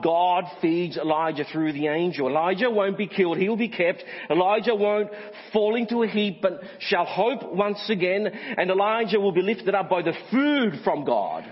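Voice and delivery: high, forceful pulpit proclamation; impassioned preaching. Real, not acted. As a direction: preach it with rising fervour.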